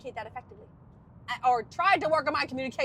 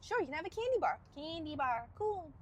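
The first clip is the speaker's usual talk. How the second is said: higher, softer playful answer; obliging reply to child; 4 semitones higher, 5 dB quieter than usual. Acted. Answering a child asking for candy, playfully agreeable. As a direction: play the grown-up saying yes.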